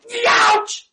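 high, loud hammed-up youch; patient's yelp at the doctor's stab, mock pain. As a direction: yelp like the doctor just stabbed you. You go.

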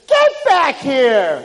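slow, high, loud royal command; king ordering the boy back; commanding call. Acted. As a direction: call him back sternly.

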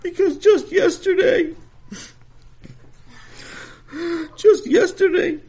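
high, slow crying wail. Acted. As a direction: wail it like a distraught kid. Sammy wails in distress.

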